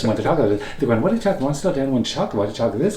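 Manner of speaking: put-on quick, lilting Quebecois accent. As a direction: imitate fast Quebecois talk. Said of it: "talk a little bit like this".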